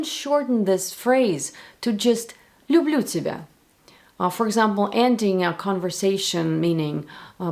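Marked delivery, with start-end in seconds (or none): none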